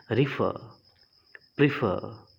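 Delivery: रिफर and प्रीफर with a short i: ri-fer, pri-fer; correct pronunciation. Shown as a demonstration. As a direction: repeat रिफर, प्रीफर clearly as models. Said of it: "रेफर नहीं होता है".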